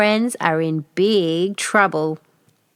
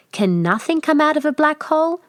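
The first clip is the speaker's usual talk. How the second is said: put-on child voice; 8 semitones higher than usual. Curious child question.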